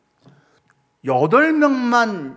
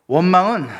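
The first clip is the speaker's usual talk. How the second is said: ordinary delivery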